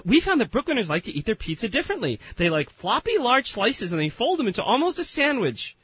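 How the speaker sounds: mock a bubbly marketing spokeswoman; imitating the spokeswoman. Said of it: "I'll try to imitate her voice".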